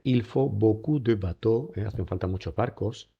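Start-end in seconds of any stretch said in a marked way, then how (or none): 0.0-1.8 beaucoup and bateaux drawn out slowly; slow model French. Shown as a demonstration. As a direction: read it slowly and clearly. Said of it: "Fijaros en esta frase".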